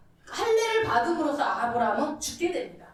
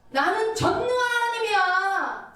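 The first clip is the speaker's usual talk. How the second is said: higher, louder, slow stern rebuke; stern rebuking God; 8 semitones higher, 5 dB louder, slower than usual. Acted. God rebuking Abraham after thirteen years of silence, stern loud rebuke. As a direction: thunder it down like an angry God. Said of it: "이거는 무서운 질책을 얘기하는 거죠".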